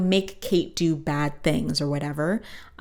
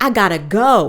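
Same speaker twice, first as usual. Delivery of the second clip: louder, higher declaration; dramatic announcement; 4 semitones higher, 12 dB louder than usual. Acted. D W tells the family she's leaving, declared loud. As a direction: blurt it out like a big exit line.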